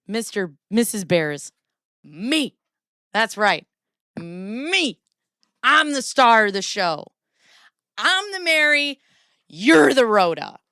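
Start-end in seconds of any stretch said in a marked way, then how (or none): 2.0-10.6 loud, high, grand self-proclamation; diva boast. Real, not acted. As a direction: proclaim yourself the star.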